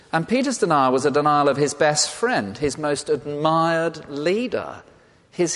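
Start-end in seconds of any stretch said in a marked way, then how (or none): none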